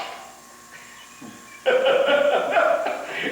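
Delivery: high, loud burst of laughter; real high-pitched laughter. Real, not acted. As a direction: crack up at the memory.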